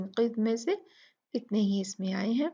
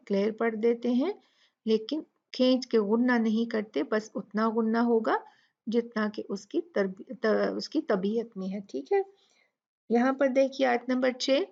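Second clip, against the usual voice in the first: normal talking voice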